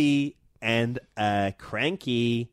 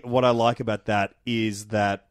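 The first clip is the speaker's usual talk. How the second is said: ordinary delivery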